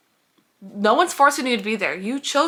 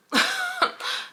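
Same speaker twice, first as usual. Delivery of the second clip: shrieking little laugh; real high laugh; high squeal of laughter. Real, not acted. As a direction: let a squeaky laugh escape. Laughs at own joke.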